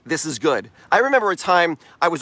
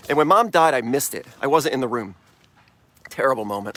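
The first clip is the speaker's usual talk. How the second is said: normal talking voice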